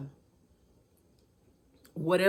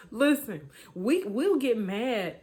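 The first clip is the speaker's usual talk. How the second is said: ordinary talk, nothing marked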